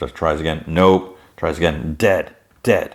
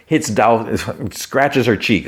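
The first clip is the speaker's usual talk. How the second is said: normal talking voice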